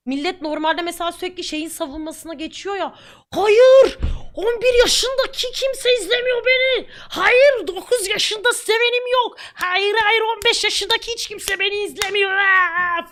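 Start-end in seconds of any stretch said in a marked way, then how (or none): 3.3-13.1 high, loud, mock-tearful protest; overdone wailing denial, much higher and louder; mocking whiny defender. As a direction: ham up a panicky kid denying everything. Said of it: "falan diye millet çıldırıyor ya".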